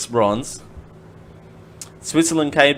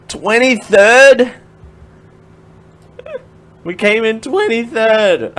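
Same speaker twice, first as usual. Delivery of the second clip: disbelief; 8 semitones higher, 6 dB louder, slower than usual. Real, not acted. Learning Australia's placing.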